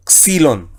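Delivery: "Xilón" stressed on the first syllable, "KSI-lon"; stress on penultimate syllable. Shown as a demonstration. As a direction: hit "ksi" in "Xilón", then let "lon" fall. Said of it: "entonamos el acento".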